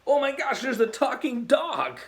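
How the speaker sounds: high, startled cry of disbelief; shocked. Pretend. Youth pastor imagines his dog talking, high startled surprise. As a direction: squeal it like you just heard a dog talk. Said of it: "I think I'd be like".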